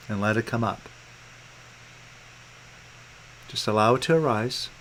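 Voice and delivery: hushed, low coaching with room to breathe; calm guidance. Real, not acted. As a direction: ease them gently into letting go. Guides client through releasing.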